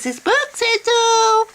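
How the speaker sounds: high, drawn-out mock proclamation; hammed-up sing-out on a high pitch; mocking zealot. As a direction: crow it slowly and high, parodying a zealot. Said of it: "this other guy who's like".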